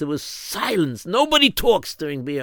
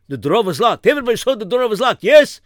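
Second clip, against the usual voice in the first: put-on high frantic Yiddish accent; panicking old rabbi; 8 semitones higher, 4 dB louder than usual. Rav frantic over stolen coins.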